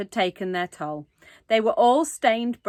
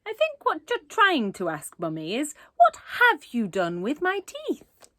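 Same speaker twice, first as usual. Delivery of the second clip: sugary dentist; 8 semitones higher than usual. A narrator's reading. Dentist mockingly restates the question.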